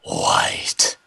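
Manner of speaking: breathy unvoiced whisper. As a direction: whisper it like a secret. Mock dragon line whispered.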